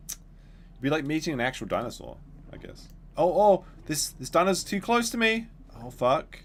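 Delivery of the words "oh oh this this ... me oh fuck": high, loud panicked gamer; panicking gamer. Real, not acted. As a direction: panic as the dinosaur closes in. Dinosaur too close in game.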